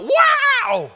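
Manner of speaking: put-on high, loud kid's wow; excited kid. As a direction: gasp it like a thrilled little kid. Kid bouncing out of van.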